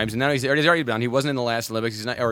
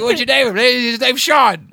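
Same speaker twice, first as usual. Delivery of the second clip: high, loud, slow acted exchange; mock dialogue; 12 semitones higher, 9 dB louder, slower than usual. Acting out a confused naming chat, high loud slow voices. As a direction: play both sides of a silly conversation. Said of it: "I was like".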